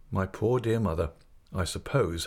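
usual speaking voice

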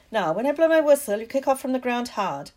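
Madam Hooch barks at her pupils; loud instructions. Acted. Madam Hooch calls out loud, fast instructions to the class.

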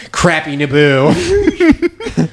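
very slow, drawn-out delivery; slow mock announcement. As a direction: drag it out deadpan. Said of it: "I just hear".

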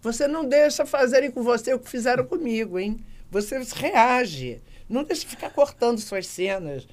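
a mother pushing her daughter to fight back; mother scolding. Retold or imitated. Mãe cobrando a filha, voz alta e enfática. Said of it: "vem minha mãe e começa assim".

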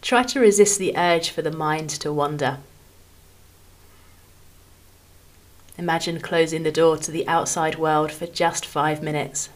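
calm, measured phrases with long pauses between them; guided meditation. Acted. Podcast host guiding listeners through a body scan, calm meditation voice. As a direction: ease each phrase gently downward, leaving room to rest.

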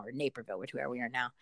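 normal talking voice

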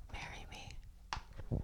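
breathy secret whisper. Retold or imitated. Host reading the essay, whispering the quoted proposal. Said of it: "I would mutter the words".

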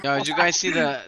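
usual speaking voice

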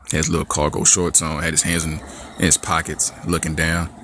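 ordinary talk

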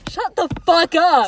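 slow, loud, high outburst; mock angry shout. Silencing the co-host's running gag, mock angry shout. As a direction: shout each word in playful outrage.

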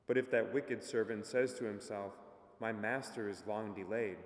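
formal liturgical reading, projected; gospel proclamation. Acted. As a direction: proclaim it like a priest at mass.

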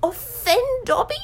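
high, slow, choked-up elf; overwhelmed elf, drawn out and high. A narrator's reading. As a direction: choke it out in disbelief. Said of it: "choked the elf".

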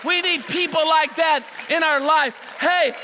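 high, impassioned pulpit cry; full-force preaching. Real, not acted. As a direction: preach it with rising fervor, stretching key words.

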